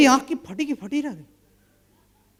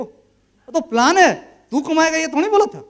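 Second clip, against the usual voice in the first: retold scolding; 6 semitones higher, 5 dB louder than usual. Scolding friend over plan.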